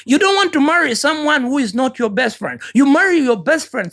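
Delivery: usual speaking voice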